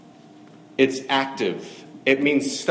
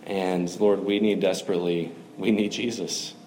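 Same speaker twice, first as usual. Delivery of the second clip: closing prayer; 4 semitones lower than usual. Real, not acted.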